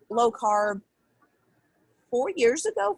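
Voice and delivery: normal talking voice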